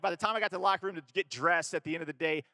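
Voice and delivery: usual speaking voice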